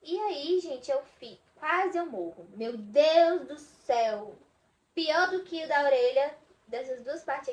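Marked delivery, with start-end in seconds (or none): 2.5-4.4 slow, drawn-out and louder; groaning meu Deus at the memory; dramatic exclamation. Real, not acted. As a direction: stretch it out in disbelief. Reliving piercing pain.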